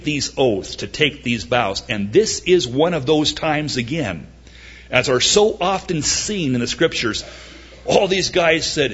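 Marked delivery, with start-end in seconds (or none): none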